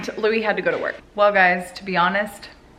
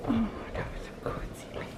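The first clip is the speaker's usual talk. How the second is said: tender whisper; 14 dB quieter, faster than usual. Real, not acted. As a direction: whisper it lovingly. Cooing to just-woken child.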